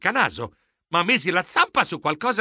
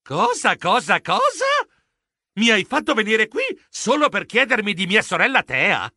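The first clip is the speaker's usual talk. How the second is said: high-pitched incredulous questioning; shocked disbelief; 5 semitones higher, 3 dB louder than usual. A narrator's reading. Geronimo realises why his friend summoned him, shocked. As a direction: repeat it aghast, then protest. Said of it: "Io sbiancai".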